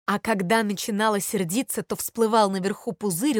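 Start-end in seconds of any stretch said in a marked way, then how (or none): none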